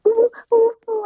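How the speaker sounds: loud, high-pitched oohs; sudden high "ooh, ooh"; high hooting "ooh". Acted. Acts out noise she will say.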